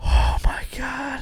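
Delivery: whispered through laughter; amused whisper; breathless whisper. Real, not acted. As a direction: gasp it out in a whisper, slowly. Laughing at the joke.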